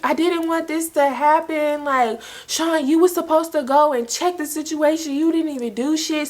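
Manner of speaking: high, wailing retold sobbing; crying harder. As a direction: pitch it way up, like crying too hard to hold it together. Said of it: "And now I'm crying even harder".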